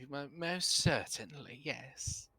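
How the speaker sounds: high, airy diva voice; flamboyant lady voice. Acted. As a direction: purr it like a grand dame. Davine's affected voice.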